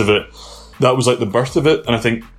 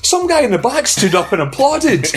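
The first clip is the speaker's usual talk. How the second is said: amazed disbelief; 13 semitones higher than usual. Retold or imitated. Retold amazement watching match video.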